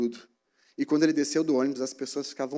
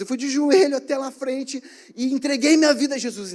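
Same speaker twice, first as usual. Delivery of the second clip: loud testimony; 8 semitones higher, 6 dB louder than usual. Retold or imitated. Claudinho sharing his conversion story out loud, emotional and loud. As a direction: let the whole bus hear your conversion. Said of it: "ele falou tão alto".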